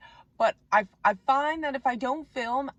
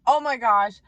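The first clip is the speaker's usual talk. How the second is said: delighted; 9 dB louder than usual. Retold or imitated. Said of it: "I was like".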